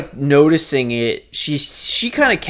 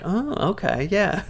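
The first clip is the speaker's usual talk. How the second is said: uneasy recognition; 6 semitones higher, 6 dB quieter than usual. Retold or imitated. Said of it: "where I'm like".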